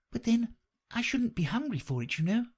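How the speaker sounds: a lightly voiced young girl; young Alice. A narrator's reading. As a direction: lift the voice up for a curious girl. Alice's voice in the story.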